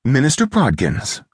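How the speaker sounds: high, slow, feminine caress. A narrator's reading. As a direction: soothe like a clean summer breeze. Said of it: "her voice like the caress".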